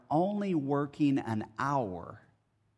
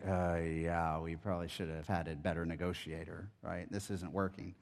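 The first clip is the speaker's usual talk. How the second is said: much softer and lower, deflated mumble; sheepish admission; 8 semitones lower, 9 dB quieter than usual. Retold or imitated. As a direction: concede meekly like caught-out workers. Workers meekly admit the deal.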